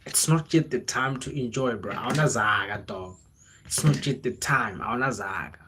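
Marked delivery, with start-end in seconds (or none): none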